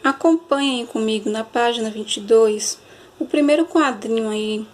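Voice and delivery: ordinary talk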